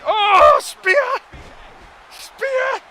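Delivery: high, loud play-by-play cheering; excited shouting. Real, not acted. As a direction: yell the count like a thrilled fan. Cheering the match finish.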